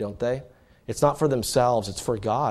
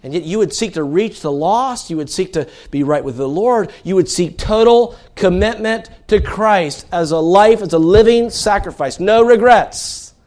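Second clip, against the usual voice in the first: loud, high preaching push; impassioned sermon delivery; fervent preaching; 7 semitones higher, 10 dB louder than usual. Acted. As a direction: drive the point home to the congregation. Preaching build.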